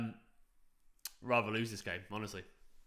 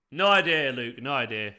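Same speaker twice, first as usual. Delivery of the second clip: ordinary talk, nothing marked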